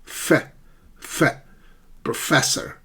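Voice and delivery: pro-FESS-or, syllable first; second-syllable stress. Shown as a demonstration. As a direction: isolate "Fe", then "professor" as pro-FESS-or. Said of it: "it's the second syllable".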